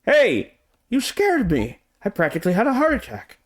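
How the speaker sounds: high startled yelp; startled scream. Acted. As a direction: jump out of your skin.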